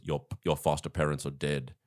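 lightly voiced Terminator, very low and soft; low, deadpan machine imitation. Terminator telling boy in meme, low deadpan imitation. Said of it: "he goes".

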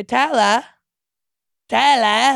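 drawn-out Aussie Taylor; Australian accent. Retold or imitated. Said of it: "it's so cute how they say".

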